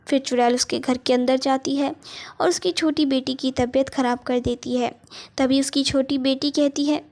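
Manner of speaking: usual speaking voice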